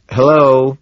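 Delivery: sharp loud hello; loud interrupting hello. A narrator's reading. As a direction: break in on the talk. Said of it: "I interrupt".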